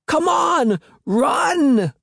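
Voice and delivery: high, scared yell to run; a boy yelling at his friends to flee; high panicked shout; shrill frightened shout. A narrator's reading. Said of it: "Will cried".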